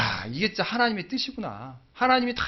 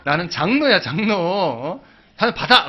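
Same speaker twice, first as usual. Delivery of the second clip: louder, higher bossy shout; boss's loud order; 4 semitones higher, 8 dB louder, slower than usual. Retold or imitated. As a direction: bark it like a pushy boss at dinner. Vice president ordering drinks.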